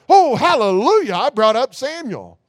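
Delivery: high, loud mock hallelujah, hammed-up; mock joyful cry. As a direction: shout it like a happy mock celebration. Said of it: "That isn't what she's crying out at all".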